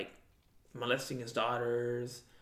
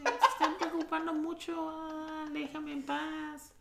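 put-on bratty kid mouthing off to mom, whiny and high; whiny kid cussing mom; 12 semitones higher than usual. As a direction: whine it like a bratty kid half laughing. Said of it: "What'd you say?".

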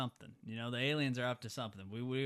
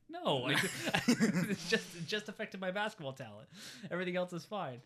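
higher, giggling mock answer; laughing through a quote; 7 semitones higher, 4 dB louder than usual. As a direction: break into laughter mid-line. Retelling a player's embarrassed answer.

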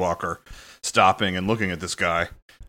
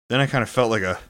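ordinary talk

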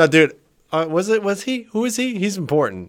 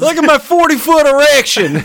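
much higher, louder ad pitch; Brad Benson impression; 12 semitones higher, 5 dB louder than usual. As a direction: belt it like the dealer ad. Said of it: "you sounded just like him".

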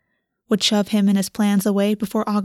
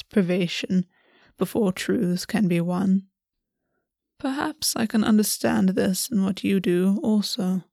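Raven Prince. A narrator's reading. Raven Prince's long speech.

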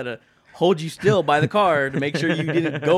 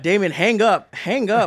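raised, higher pleading order; retold urgent shout; 7 semitones higher, 6 dB louder than usual. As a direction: act out urging a friend off the phone. Retold urging friend to end call.

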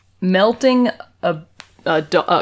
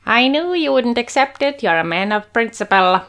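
a light hint of a higher voice; lightly voiced driver, a touch higher; normal man voice; 5 semitones higher than usual. Driver speaking to Sherlock in a lightly higher voice. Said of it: "what normal humans sound like".

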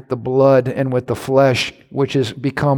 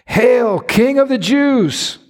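high, slow sneering hail; mocking taunt; 10 semitones higher, slower than usual. Soldiers jeering at Jesus, high mocking taunt.

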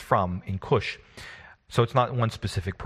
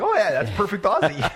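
squeaky, loud, hammed-up Australian accent, much higher; mock Aussie; 11 semitones higher, 6 dB louder than usual. Urchins praising his accent in a hammed-up Aussie accent. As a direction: overdo a jokey Aussie voice. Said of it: "That's terrible".

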